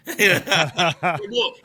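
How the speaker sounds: bursting into high, loud laughter. Real, not acted. Telling the basketball photo story, a loud high laugh. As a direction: laugh hard at your own joke.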